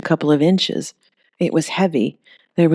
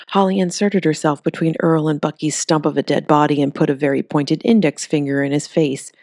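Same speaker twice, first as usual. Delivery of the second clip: plain reading voice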